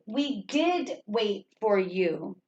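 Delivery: slow, soft reading, weight on "did"; stress on did. Shown as a demonstration. Teacher modelling the corrected sentence softly. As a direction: draw it out quietly, leaning on "did". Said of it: "emphasize, you're going to exaggerate".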